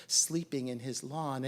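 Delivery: ordinary delivery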